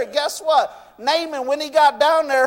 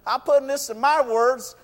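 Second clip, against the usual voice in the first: ordinary delivery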